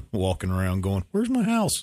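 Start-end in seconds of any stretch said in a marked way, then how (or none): 1.1-1.8 high, puzzled deer voice; lost deer voice. Retold or imitated. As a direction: play the dazed deer searching for home, high.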